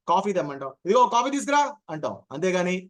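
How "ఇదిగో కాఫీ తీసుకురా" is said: brisk home order. Retold or imitated. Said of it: "talking habits".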